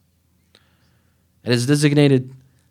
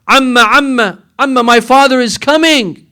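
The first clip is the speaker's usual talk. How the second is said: put-on high, louder little girl calling; child's voice; 7 semitones higher, 6 dB louder than usual. Little girl waking her aunt, high and loud. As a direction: cry out like a small excited child. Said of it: "she sees baby Ruqayya wake up".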